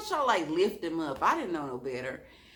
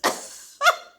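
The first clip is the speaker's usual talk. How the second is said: breathy laugh; 7 dB louder than usual. Real, not acted. After shocked reaction.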